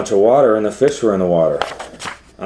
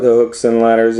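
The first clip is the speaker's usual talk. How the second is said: plain reading voice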